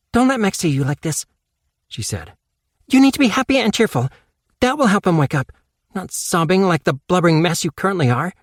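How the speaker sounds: put-on high, fast girl voice telling herself off; Casey scolding herself. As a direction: snap at yourself to pull it together.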